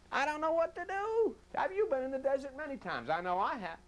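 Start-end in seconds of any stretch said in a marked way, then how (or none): 0.1-1.3 much higher, plaintive cry; lost and helpless. Retold or imitated. Preacher voicing lost Jesus in the desert, helpless higher cry. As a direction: sound completely at a loss.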